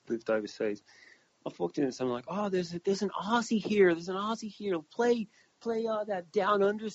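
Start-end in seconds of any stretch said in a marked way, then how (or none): 2.3-6.9 high, eager mock excitement; excitedly calling for a song, hammed-up; excited onlookers. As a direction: imitate excited strangers, pitched well up. Retold strangers excitedly spotting an Aussie.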